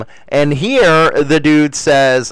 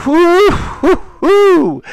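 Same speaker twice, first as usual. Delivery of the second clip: excited whoo; slower than usual. Real, not acted. Excited cheer about hockey jerseys.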